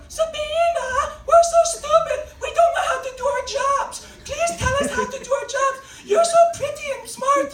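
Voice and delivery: very high, loud, mock-helpless wail; stupid-people voice. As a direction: plead like a hammed-up helpless idiot. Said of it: "doing her impression of stupid people".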